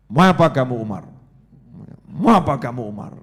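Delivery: loud, low confrontation; gallant challenge. Acted. Prophet grabs Umar and confronts him, loud gallant challenge. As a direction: challenge the intruder with bold authority.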